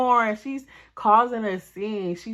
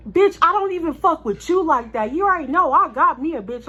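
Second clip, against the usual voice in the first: heated retort; 7 semitones higher, 5 dB louder than usual. Retold or imitated. Boyfriend arguing back.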